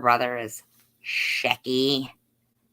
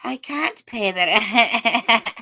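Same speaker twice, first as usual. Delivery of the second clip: high, slow, laughing damsel wail; 11 semitones higher, 6 dB louder, slower than usual. Acted. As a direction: wail it like a melodrama heroine. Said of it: "act out the whole live stream in different voices".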